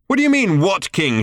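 high, loud shout. A narrator's reading. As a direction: bark it back. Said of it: "shouted Ford".

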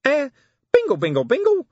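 tiny high nervous imp chirp; small nervous voice. Acted. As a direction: squeak it out like a jittery imp. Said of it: "said a small and very nervous voice".

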